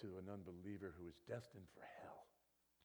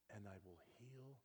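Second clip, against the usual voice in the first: soft whispered correction; preacher whispering the verse; 7 dB quieter than usual. Acted. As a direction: hush it down. Sudden whisper.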